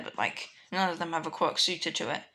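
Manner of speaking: plain reading voice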